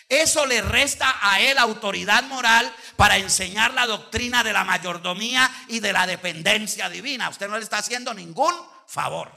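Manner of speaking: preacher hammering a warning to the church; preaching. Acted. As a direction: drive the point home from the pulpit. Preaching point to the congregation.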